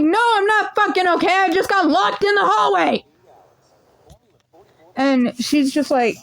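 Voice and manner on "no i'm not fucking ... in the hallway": exasperated. Retold or imitated. Upset answer to teacher.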